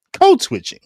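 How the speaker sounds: "Code switching" punched high and loud; emphatic. Shown as a demonstration. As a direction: hit "Code switching" like a headline. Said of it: "this is called code switching".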